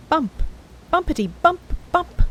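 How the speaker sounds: bumps bounced up high; bouncing bumps. A narrator's reading. Narrator acting out the raft hitting bumps, bouncy. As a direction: bounce each bump upward.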